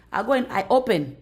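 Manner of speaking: ordinary delivery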